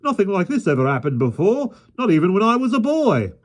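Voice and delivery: high fretful wailing; panicky. A narrator's reading. Park keeper frets about the lion, high panicky voice. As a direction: fret in a panic.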